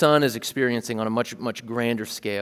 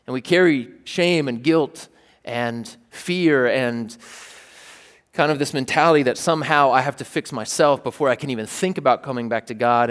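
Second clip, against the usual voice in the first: ordinary talk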